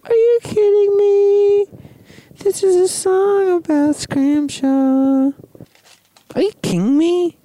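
very high, loud screeching outburst; shrieking disbelief. Acted. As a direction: shriek it in mock outrage. Shrieking disbelief, comic bit.

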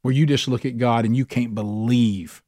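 ordinary talk, nothing marked

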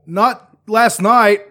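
drawn out denial; acted reply. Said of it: "I was like".

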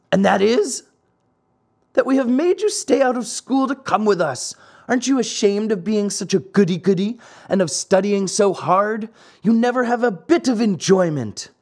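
put-on high-pitched boy tormentor, reproachful; taunting schoolboy. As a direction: needle him like a teasing playmate. Boys taunt Pinocchio.

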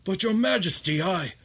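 the son protests to his father; protesting son. Acted. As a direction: cut in, pleading and high. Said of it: "And Satiros says".

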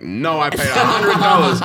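loud laughing protest. Retold or imitated. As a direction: insist loudly through a laugh. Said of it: "I'm like".

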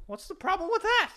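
high, indignant dopey question; imitating a clueless predator, high; high mock creep voice. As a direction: act innocent in a high squeak. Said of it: "He goes".

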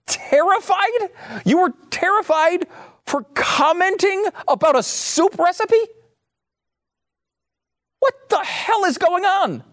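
high-pitched, disbelieving rant; incredulous outburst. Real, not acted. Host scoffing at someone terrified over soup, incredulous. Host ranting at a quoted fan.

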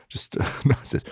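breathy laughing whisper. Real, not acted. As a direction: laugh it under your breath. Narrator laughs quietly.

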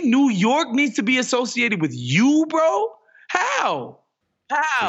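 incredulous. Real, not acted. Host ranting to his co-host, climbing high in disbelief. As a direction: pitch shooting up on bro and how. Ranting about the Amazon deal.

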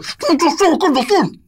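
very high, loud stand-up shout; Dane Cook impression. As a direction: yell it into the mic. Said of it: "Get the microphone".

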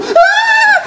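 a sudden, very high, loud mock shriek, laughing; high laughing mock yelp of alarm; startled shoppers' scream. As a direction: squeal like a startled stranger running off. And the people go.

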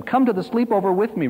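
put-on high child's plea to a brother; urgent, high-voiced coaxing; begging. A narrator's reading. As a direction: beg like a little boy, pitched well up. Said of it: "Richard begged his brother".

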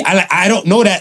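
ordinary talk, nothing marked

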